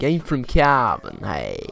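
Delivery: put-on a slow, light take on a Cavan lad's accent; mild Cavan accent, slow and easygoing. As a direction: drawl it lightly like a lad from Cavan. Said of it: "the way the lads from there talk".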